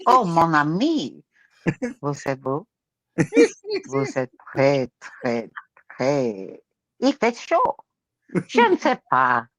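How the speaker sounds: halting, low mock-French accent. [name]'s turn in accent game, halting French accent. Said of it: "with the French accent".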